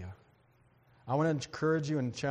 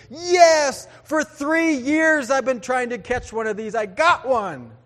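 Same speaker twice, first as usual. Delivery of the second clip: loud, high yell of victory; excited triumph; 8 semitones higher, 10 dB louder, slower than usual. Retold or imitated. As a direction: shout it like a long-awaited catch finally landed. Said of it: "the guy just was so excited".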